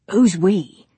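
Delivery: eager film director with a laugh; pushy producer charm; brash director. Acted. Brady asks.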